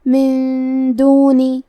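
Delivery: "من دونی" slowly chanted, the noon held in a long hum; slow, level tajweed chant of "من دونی"; Quranic recitation demo. Shown as a demonstration. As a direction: draw out the nasal hum in "من" before "دونی". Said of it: "حکم کو ادا کریں گے".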